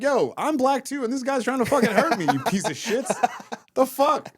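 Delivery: high-pitched, half-laughing outrage; retold indignant protest. Telling bystanders he was attacked, high indignant protest. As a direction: protest to the crowd in mock outrage.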